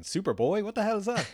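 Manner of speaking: high-pitched incredulous question; baffled disbelief. Retold or imitated. Retelling a first reaction to Superboy, baffled. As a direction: retell it as a baffled outburst, voice leaping up.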